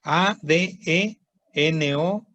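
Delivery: A D E N O M E R O, letter by letter, very slowly; slow spelling out. Shown as a demonstration. Teacher spells the word for students.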